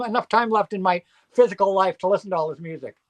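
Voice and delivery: ordinary talk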